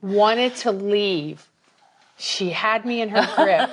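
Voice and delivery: crying while laughing. Real, not acted. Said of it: "Now I'm crying".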